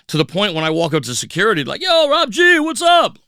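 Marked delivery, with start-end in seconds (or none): 1.8-3.2 much higher, louder hey-buddy call; excited greeting. Retold or imitated. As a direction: greet the regular DJ like an old friend. Security greets him like a regular.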